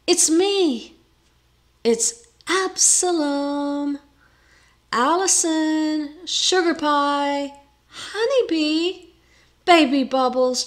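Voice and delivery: high, drawn-out wooing coo; high-pitched cooing suitor. A narrator's reading. Absalom wooing Allison through the shutters, high-pitched coo. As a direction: coo sweet nothings in a high, stretched voice. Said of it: "followed by a quiet, high-pitched coo".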